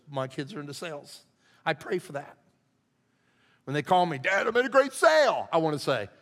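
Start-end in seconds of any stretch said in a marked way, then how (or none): 4.2-5.5 voicing his son, higher and louder with pride; a proud kid bragging about a sale; excited son. Son calling with good news.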